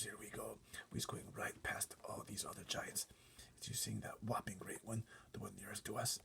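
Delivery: unvoiced warning whisper; giant whispering. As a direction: hush her, breathless and secret. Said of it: "the B F G whispered down to her".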